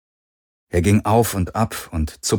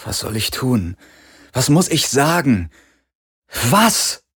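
desperate questions; 5 semitones higher than usual. A narrator's reading. Garland pleads desperately.

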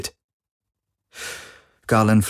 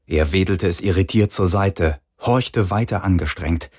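ordinary delivery, nothing marked

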